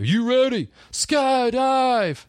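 high, drawn-out hype call then a shout; instructor hyping. Retold or imitated. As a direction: pump him up at the open door. Instructor hyping before jump.

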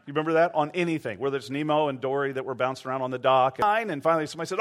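ordinary talk

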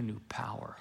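hushed, whispered reveal. Acted. Preacher whispering the promise of new power to his congregation. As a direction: drop to a whisper to land the point. Sermon climax dropped to whisper.

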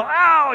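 loud, high "ow" yell; sudden acted cry of a man just stung; yelp of pain. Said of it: "He lets out a yell".

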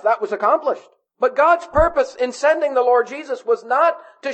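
high, insistent sermon delivery; emphatic preaching. Real, not acted. Preaches at full force.

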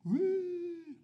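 loud, high wail imitating a crying dad; mock a sudden burst of crying; imitated wailing. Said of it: "crying like you ain't never heard".

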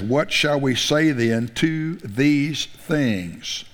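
very slow, weighty question; slow scripture quote. Real, not acted. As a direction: draw out the question to the church. Quoting the key verse slowly.

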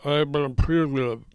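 slurred, mumbled words. A narrator's reading. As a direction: mumble it, barely shaping words. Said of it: "mumbled something".

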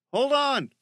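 high, loud shout; calling out. Acted. Michael to Bear in trouble, calling out. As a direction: yell it across the forest. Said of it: "Michael called out".

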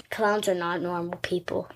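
unvoiced secret whisper about clowns; spooky whisper. Child whispering a spooky secret to her mom. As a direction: hush it like a scary secret.

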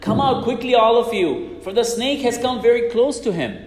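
put-on high, urgent child's call to friends; children calling out. Children calling friends from windows, high urgent shout. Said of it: "the children called their friends".

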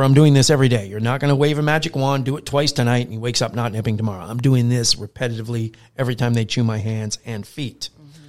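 ordinary talk, nothing marked

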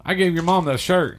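spring the trap like a smug cop; cop's gotcha. Retold or imitated. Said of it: "he goes".

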